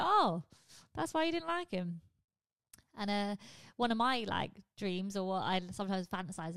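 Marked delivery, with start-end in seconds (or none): 0.0-2.0 sudden high "oh" of realisation; mock dawning surprise; a lightly acted penny-drop. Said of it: "you go".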